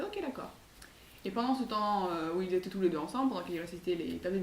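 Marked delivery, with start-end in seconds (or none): 0.0-0.5 put-on high, soft little-girl agreement; little girl agreeing. Girl agreeing to meet again next year, high and soft. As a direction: chirp a sweet yes. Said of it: "Lurette a fait".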